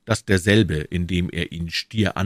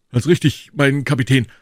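higher, louder boastful musketeer; higher, louder, brash soldier voice; eager musketeer; 7 semitones higher, 7 dB louder than usual. Acted. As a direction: Porthos booming his excuse. Porthos voiced high.

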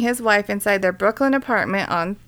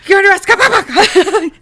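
high, loud panicky cop voice; nervous rookie cop; 11 semitones higher, 9 dB louder than usual. Retold or imitated. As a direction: squeak it out like a terrified rookie.

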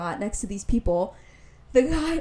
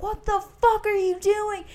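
imitated screaming; 11 semitones higher than usual. Man screaming across the street at them, high mock yell. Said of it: "like, screaming".

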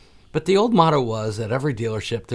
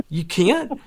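higher-pitched mock incredulous question; retold disbelief; 7 semitones higher, 4 dB louder than usual. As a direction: throw their refusal back, higher, as if astonished. Retold disbelief at dealer's refusal.

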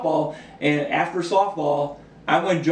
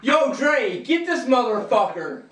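high calling-out yell; shouting to a friend; 7 semitones higher, slower than usual. Retold or imitated. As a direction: yell across the street. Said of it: "the guy behind me is like".

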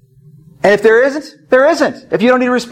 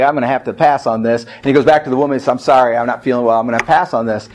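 low, subdued sickly apology; mock queasy excuse; 7 semitones lower than usual. Queasy husband begs off from the other woman, subdued excuse. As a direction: beg off lamely, pitched down.

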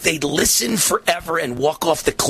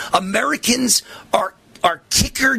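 whisper; 5 semitones higher than usual. Real, not acted. Dramatic aside in the rant.

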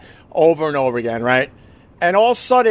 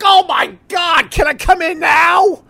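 high, loud, exasperated shout; exasperated yell; 13 semitones higher, 4 dB louder than usual. Retold or imitated. Husband pleading with the clerk, exasperated high yell. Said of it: "I'm like".

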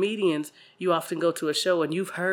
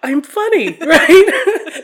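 delighted; 9 semitones higher, 11 dB louder, much slower than usual. Pretend. Acting delighted at compliment.

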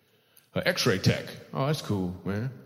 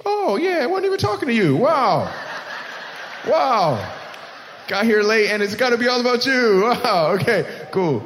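higher and louder, hammed-up offended; mock outrage; 8 semitones higher, 4 dB louder than usual. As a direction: play the insulted host for laughs. Host mock-offended by the late boss.

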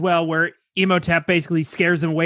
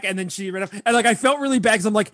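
ordinary talk

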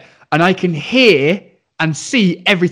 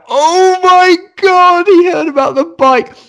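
much higher, louder, drawn-out "Oh my God"; mortified; 6 dB louder, slower than usual. Retold or imitated. The dads realise the teacher heard their quarrel, mock horrified wail. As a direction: wail of embarrassed horror. Parents mortified, head in hands.

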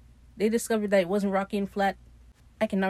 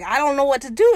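louder, higher mock panic; retold freaking out; 6 semitones higher, 7 dB louder than usual. Steve tells Ed he has no idea, in mock panic. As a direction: act out a helpless fluster, voice raised. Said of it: "Steve's freaking out".

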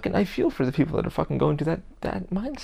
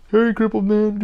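higher and louder jeering call; imitated jeering insult from a stranger; mock taunt; 6 semitones higher, 8 dB louder, slower than usual. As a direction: call out the insult like a mocking passer-by. Retelling a taunt.

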